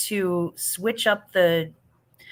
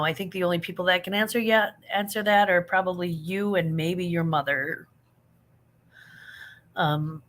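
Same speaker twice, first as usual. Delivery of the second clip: ordinary talk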